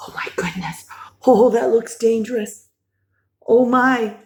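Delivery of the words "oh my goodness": soft hushed whisper; breathless whispered worry. Pretend. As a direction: whisper in suspense. Suspenseful aside while reading.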